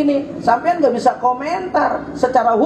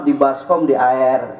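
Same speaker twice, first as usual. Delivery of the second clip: put-on parent coaxing a child; 12 semitones lower, slower than usual. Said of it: "orang tua bisa bohongin anak".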